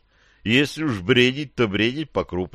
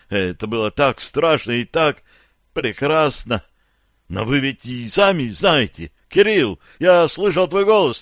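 dazed survivor; 4 semitones higher than usual. Acted. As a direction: tell it like a man waking from a nightmare. Dazed survivor recounts nuclear disaster.

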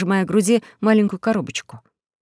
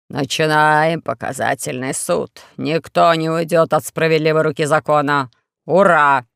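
troll herald; 4 semitones lower than usual. Acted. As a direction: drag out the proclamation like a troll. Troll opens the trial.

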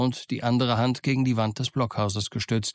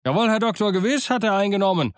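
much higher, loud eager pirate; eager Morgan; 9 semitones higher, 4 dB louder, faster than usual. A narrator's reading. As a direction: answer the doctor eagerly.

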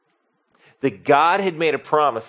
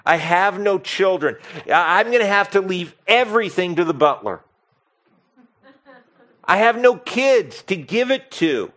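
higher and louder, an anguished complaint; pained lament; 6 semitones higher, 4 dB louder than usual. Retold or imitated. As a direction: cry out that there's no heir. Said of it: "And Abram says".

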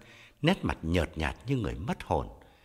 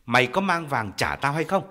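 louder, hard repeated question; insistent demand; 4 semitones higher, 6 dB louder than usual. Acted. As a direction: press her one more time. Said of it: "Khái nhắc lại".

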